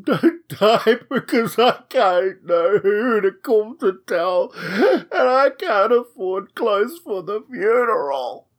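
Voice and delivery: high, drawn-out comic wailing; hammed-up sobbing plea, slow and high-pitched; tearful plea. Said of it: "burst into tears and asked him".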